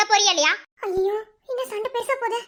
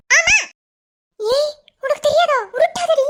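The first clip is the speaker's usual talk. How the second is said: angry scolding; 4 semitones higher, 4 dB louder, slower than usual. Acted. Angry mother scolding daughter.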